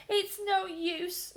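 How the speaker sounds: high, slow tearful voice; sobbing. Acted. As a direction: plead through tears. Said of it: "sobbed Peter".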